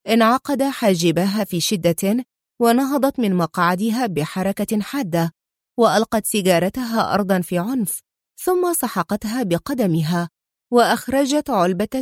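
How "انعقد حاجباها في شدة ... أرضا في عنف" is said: ordinary delivery, nothing marked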